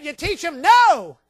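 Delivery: no rings out high, loud and slow; booming pulpit declaration; loud preaching. Acted. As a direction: hammer the word no home. Preacher hammering the point.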